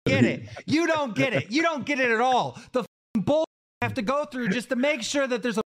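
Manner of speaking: high-pitched, exasperated ranting; worked-up, heated tirade; angry rant. Real, not acted.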